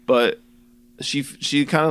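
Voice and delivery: ordinary talk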